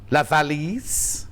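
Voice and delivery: put-on slow showy French, Yorkshire accent; hammed-up French, Yorkshire accent. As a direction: stretch out la valise with Yorkshire vowels. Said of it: "speaks French with a Yorkshire accent".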